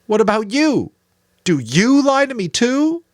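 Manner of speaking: high, loud accusing demand; boy spitting an accusation at his mentor; spat question. A narrator's reading. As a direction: spit the question at him. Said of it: "almost spat the question".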